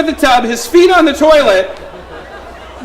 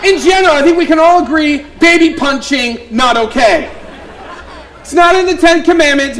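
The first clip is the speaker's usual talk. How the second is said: ordinary talk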